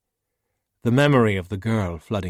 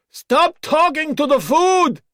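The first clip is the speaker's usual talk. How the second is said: much higher, much louder other villager; scolding shout; 11 dB louder, slower than usual. Acted. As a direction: bellow a telling-off across the camp. Voice yelling from hut.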